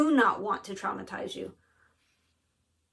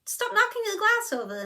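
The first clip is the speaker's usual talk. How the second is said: high, sharp and fed up; exasperated snap; 11 semitones higher than usual. Retold or imitated. Woman snapping at a stranger knocking glasses, high and fed up. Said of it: "and I'm just like".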